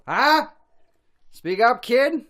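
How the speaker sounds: put-on high, loud deaf old man; old person. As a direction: play a grumpy old man who can't hear. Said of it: "A couple of old people".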